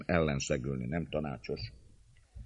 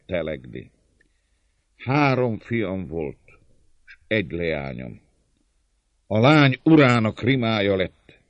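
measured grievance breaking into louder bursts; understated vengeful anger, heavy pauses; increasingly passionate bitterness; 5 semitones higher, 9 dB louder than usual. A narrator's reading. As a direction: recount each wrong with mounting hatred. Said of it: "annál szenvedélyesbekké váltak".

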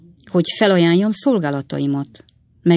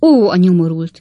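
louder indignant outburst; 3 semitones higher, 8 dB louder than usual. A narrator's reading. Spinster aunt outraged at the rival, indignant outburst. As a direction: burst out in outrage. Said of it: "erélyes felháborodással".

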